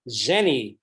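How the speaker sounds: ordinary talk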